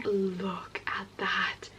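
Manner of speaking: slow, hushed whisper; awed whisper. Real, not acted. Showing viewers the cover art, slow awed whisper. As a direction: whisper slowly, in awe.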